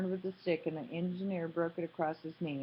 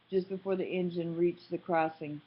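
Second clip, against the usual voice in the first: plain reading voice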